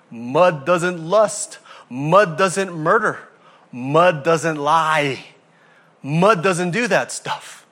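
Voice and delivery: each line hammered out slowly; slow emphatic preaching. Acted. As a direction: drive every mud home, slow and heavy. Preaching list, slow and loud.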